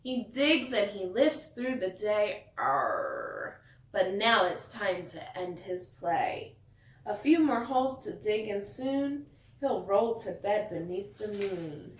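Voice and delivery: lively picture-book read-aloud; storyteller reading to kids; reading to children. A narrator's reading. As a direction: read it like bedtime story time.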